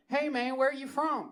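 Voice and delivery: imitated chatty bar patron; friendly stranger. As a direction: call out a casual question to a stranger.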